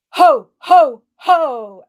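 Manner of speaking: loud, forceful ho ho ho; forceful ho laugh. Shown as a demonstration. Coach leading the group in forceful ho ho ho. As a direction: throw the ho ho out from the belly.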